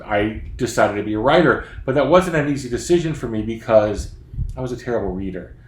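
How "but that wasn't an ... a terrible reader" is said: usual speaking voice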